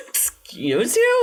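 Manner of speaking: very high, drawn-out indignation; mock outrage. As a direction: squeal it slowly, scandalized on the listener's behalf. Mock outrage at the brother hanging up.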